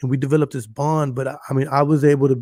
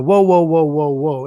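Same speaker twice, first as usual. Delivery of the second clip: startled whoa; 5 dB louder than usual. Retold or imitated. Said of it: "I'm like".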